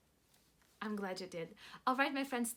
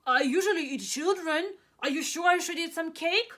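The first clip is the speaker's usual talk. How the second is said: loud, puzzled giant voice; a giant who can't believe it; doubtful giant; 4 semitones higher, 7 dB louder than usual. Acted.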